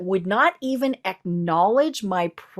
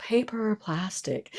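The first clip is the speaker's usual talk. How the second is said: sexy voice; 8 dB quieter than usual. Shown as a demonstration. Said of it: "in a very sexy voice".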